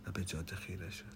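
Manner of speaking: usual speaking voice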